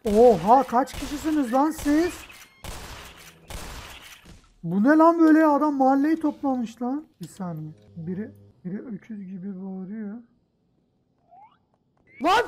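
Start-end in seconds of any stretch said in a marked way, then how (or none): 0.0-7.0 high, loud disbelieving outburst; shocked shouting. Real, not acted. Player facing a whole neighbourhood of enemies, shouting in shock. 12.2-12.4 sudden sharp yell of real fright; frightened yell. Real, not acted. Player badly scared by a roaring creature, yelling. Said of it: "Çok korktum".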